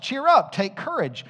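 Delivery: high, loud pulpit proclamation; preaching at full force. Acted. Preacher proclaiming Christ's victory to the congregation, full force. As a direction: ring it out to the whole church. Exhortation peak.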